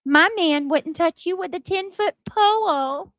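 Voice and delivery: put-on high sassy baby voice; snippy baby-voiced taunt. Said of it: "And she said".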